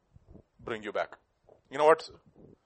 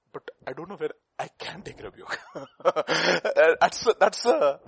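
ordinary talk

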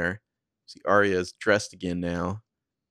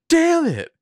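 loud, very high acted shout of frustration; furious outburst; 9 dB louder than usual. Arya as her phone burns, acted furious outburst. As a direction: explode in imitated fury.

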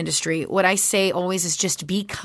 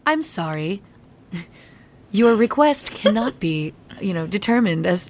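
automated phone error. Retold or imitated. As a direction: sound like a phone system failing to understand. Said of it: "And I'm like".

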